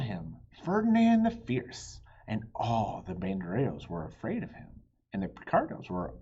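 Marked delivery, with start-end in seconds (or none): 0.6-2.0 higher and louder, proclaim the name; dramatic announcement. A narrator's reading. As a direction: boom out Ferdinand the Fierce. Announcing the fierce bull.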